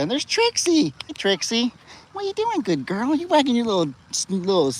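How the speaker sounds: high sing-song talk to a dog. Real, not acted. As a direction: coo at the puppy.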